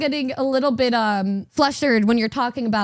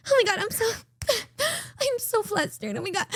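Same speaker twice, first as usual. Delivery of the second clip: imitating a flustered friend, a bit higher, half laughing; 7 semitones higher, 4 dB quieter, faster than usual. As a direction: play her all shy and flustered, mild mock. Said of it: "she was still like".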